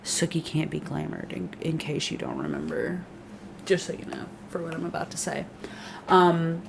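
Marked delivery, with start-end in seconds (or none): 3.7-5.4 quick hushed whisper; conspiratorial whisper; whispered aside. Real, not acted. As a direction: lean in and whisper it like a secret. Aside before a spoiler.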